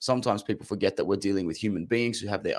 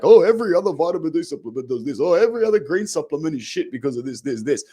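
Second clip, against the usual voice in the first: higher, louder mocking seller voice; mock sales pitch; 6 semitones higher, 4 dB louder than usual. Vlogger imitating vitamin sellers who bash other brands, mocking. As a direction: parody a pushy supplement seller trashing rival brands.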